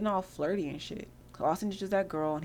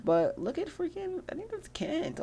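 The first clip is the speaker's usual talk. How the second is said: very high, slow disbelief; can't believe what you see; incredulous; 12 semitones higher, slower than usual. Real, not acted. Spots Kent in shorts.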